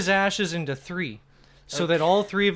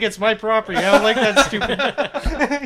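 imitating an indignant owner, loud and high; defiant; 6 semitones higher, 10 dB louder than usual. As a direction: raise your voice in mock outrage. Retelling the defiant shop owner.

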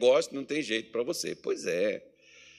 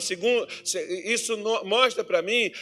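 higher, louder preaching push; fervent preaching; 8 semitones higher, 8 dB louder than usual. Acted. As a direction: drive the point home from the pulpit. Preaching builds up. (pregação fervorosa)

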